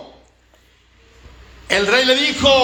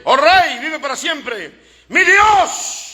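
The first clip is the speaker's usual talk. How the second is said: high, ringing call to the king; high proclamation as Daniel; exclaimed reply; 7 semitones higher, 4 dB louder, slower than usual. Acted. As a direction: call it up out of the pit with fervour. Daniel answers from the pit.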